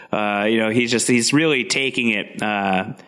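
normal talking voice

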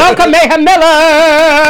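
slow, loud, high welcome cry; grand entrance announcement. Acted. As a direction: call her onstage like a showgirl. Host welcomes the star guest.

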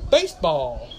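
pahk-the-cah Boston baseball; Boston accent. Shown as a demonstration. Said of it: "yes, I said in baseball".

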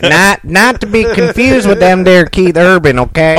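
mock hillbilly. Mock hillbilly phrasing, big pitch jump.

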